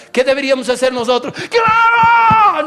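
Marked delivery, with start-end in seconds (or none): 1.3-2.7 high, loud cry of yes; excited loud congregation shout; shouted agreement. Pretend. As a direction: shout it like the whole crowd agrees.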